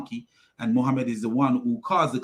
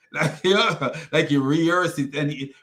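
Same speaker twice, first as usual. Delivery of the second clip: normal talking voice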